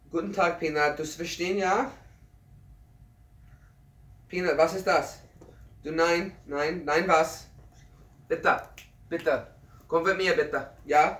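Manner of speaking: put-on playful German baby talk to a puppy; German to the dog. Shown as a demonstration. Viewer asks him to speak German to his dachshund, playful coaxing. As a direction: chat to the dog in cheerful German. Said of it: "You want me to speak German to my dog?".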